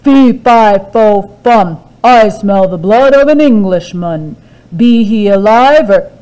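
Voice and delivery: loud booming giant chant; the giant sniffs out Jack again. A narrator's reading. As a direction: boom it like a hungry giant. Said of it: "and said".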